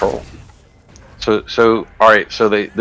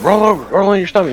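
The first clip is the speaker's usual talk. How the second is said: fast, high mock police command; retold shouted order; 11 semitones higher, faster than usual. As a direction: rattle it off like cops yelling orders.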